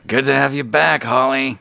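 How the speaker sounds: put-on old tattooed gnome welcoming Holly back; Benjamin's welcome. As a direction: greet her in a lightly put-on voice. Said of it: "you hear him say".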